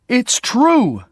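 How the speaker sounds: a sister furious at not being believed, crying out; angry cry. A narrator's reading. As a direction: fling it at him in fury. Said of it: "she cried angrily".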